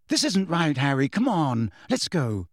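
plain reading voice

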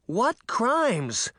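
high-pitched, baffled protest; high exclaimed question; indignant cry. A narrator's reading. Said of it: "Sabrina cried".

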